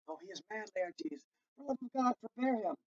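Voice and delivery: soft, pleading undertone; hushed plea. Acted. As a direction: quietly beg them to stop. Queen pleading softly.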